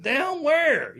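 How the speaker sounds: loud, high, baffled "down where?"; a high confused retold question; puzzled. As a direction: blurt it out, genuinely confused. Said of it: "I said".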